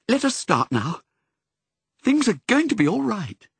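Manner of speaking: a bright, determined lift in the voice; said brightly. A narrator's reading. Missus rallying Pongo to set off again, bright. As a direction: cheer yourself up and push on. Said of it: "and said brightly".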